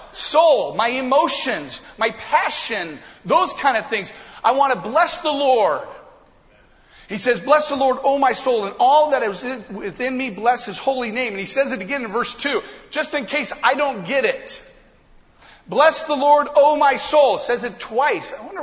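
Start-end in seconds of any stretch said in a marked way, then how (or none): 0.1-5.9 loud, high urging of his own soul; rousing self-talk. Retold or imitated. David urging his own soul to bless God, loud. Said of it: "He's talking to himself". 7.4-11.3 loud fervent psalm. Retold or imitated. David rousing his soul. 15.7-17.4 loud, high ringing cry of praise; loud fervent psalm. Retold or imitated. David crying out the verse twice, loud and high.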